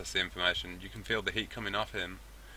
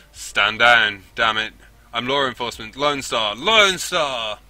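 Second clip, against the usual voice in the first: loud roar, climbing on the last call; hoarse roar; 3 semitones higher, 11 dB louder, slower than usual. Acted. Duncan identifies himself to police, hoarse roar. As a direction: roar your authority from behind cover. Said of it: "His voice comes out in a hoarse roar".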